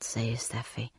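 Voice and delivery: ordinary delivery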